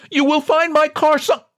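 high, cut-off desperate cry. A narrator's reading. John at the door to the president, desperate. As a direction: blurt it out before the door shuts. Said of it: "desperately tried to add".

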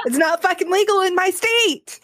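high-pitched, exasperated outburst. Real, not acted. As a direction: vent it high and indignant, half in fun. Venting about cannabis laws at home.

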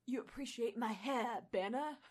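a hint of an Australian accent, teasing. Reader voicing Thor in a lightly Australian accent. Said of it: "still attempting it".

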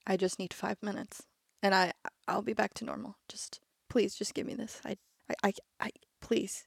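soft broken whispered plea; pleading through tears. Acted. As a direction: beg quietly, barely holding it together. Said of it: "tears are still streaming".

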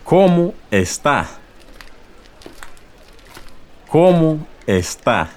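"¿Cómo está?" high and slow, no final s; slow formal model. Shown as a demonstration. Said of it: "A formal greeting in Spanish would be".

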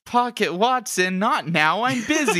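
high-pitched, hammed-up brush-off; mock exasperation. Detective fends off interrupting helper.

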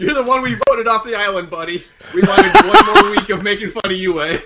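loud laughing teasing. Real, not acted. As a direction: rib him while laughing.

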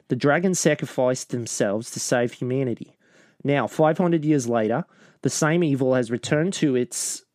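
synopsis read aloud in an Australian accent. Shown as a demonstration. Co-host reads movie synopsis on air, Australian accent. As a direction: put on an Australian accent and narrate the story. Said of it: "I'm gonna read it in my Australian accent".

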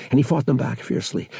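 ordinary delivery, nothing marked

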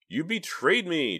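high, slow, loud accusation; furious shout. A narrator's reading. Justine shouting at her uncle, furious. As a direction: hurl it at him. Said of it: "Justine shouted at him".

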